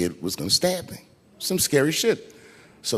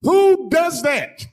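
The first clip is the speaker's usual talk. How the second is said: high, loud, drawn-out cry; indignant high-pitched yell; exasperated outrage; 6 dB louder, much slower than usual. Acted. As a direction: wail it in comic disbelief. Outrage at wife opening the box.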